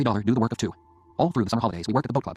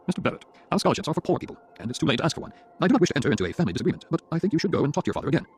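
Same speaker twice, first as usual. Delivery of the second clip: higher, formal official's voice; formal law school head; 6 semitones higher than usual. Acted. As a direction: turn the student down formally.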